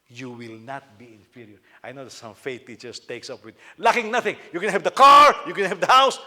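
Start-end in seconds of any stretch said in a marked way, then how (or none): 3.8-6.2 loud, high, mocking preacher's promise; mock prosperity preacher. Preacher mocking faith teachers, loud and high mock promise. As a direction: belt it out like a showy prosperity preacher.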